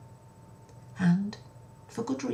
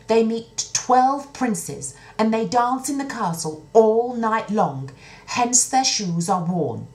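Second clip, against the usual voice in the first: higher, louder, slow telling; earnest soldier; 8 semitones higher, 7 dB louder, slower than usual. Acted. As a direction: lay out the secret slowly for the king. Soldier explains to the king.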